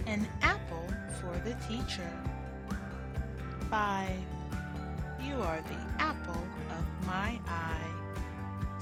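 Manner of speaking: ordinary delivery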